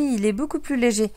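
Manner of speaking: normal talking voice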